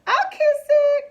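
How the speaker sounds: very high, loud sweet offer; little girl eager to help; little sister cry. A narrator's reading. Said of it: "cried Frogalina".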